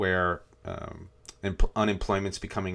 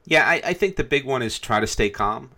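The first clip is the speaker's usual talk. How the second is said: "try" pushed louder and higher; stress on try; 5 semitones higher, 6 dB louder than usual. Shown as a demonstration. Host advising listeners, hitting "try" louder and higher. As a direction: lean on "try" in "try to stay calm". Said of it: "emphasis on try".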